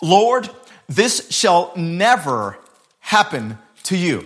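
slow, drawn out with pauses; a hint of Peter's protest, dragged out. Peter telling Jesus it won't happen, slow and drawn out. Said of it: "He says".

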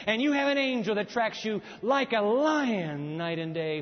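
loud, driving sermon delivery; full-force preaching. Acted. Preacher telling the church about angels around them, preaching loudly. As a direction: preach it out over the congregation.

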